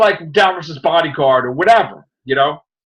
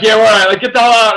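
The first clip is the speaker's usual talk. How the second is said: brusque shout; 5 semitones higher, 7 dB louder, much faster than usual. Retold or imitated. Retold brusque dismissal of Doctor.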